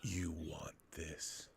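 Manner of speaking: slow, sleazy, breathy near-whisper; tempting devilish whisper. Pretend. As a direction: lure them in with a lascivious hush. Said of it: "The most sleazy and lascivious way possible".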